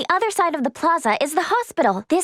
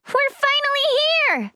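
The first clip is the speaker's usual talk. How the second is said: high, delighted exclamation; high-pitched cheer; excited arrival; 10 semitones higher than usual. Acted. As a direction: burst out high with relief at arriving. Character celebrates arriving at last.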